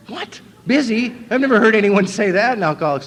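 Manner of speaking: high, loud disbelief at being turned down; what, busy?, squeaked out in shock; mock outrage at a refusal; incredulous. Retold shock at sponsor refusal.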